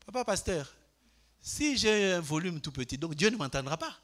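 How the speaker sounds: ordinary delivery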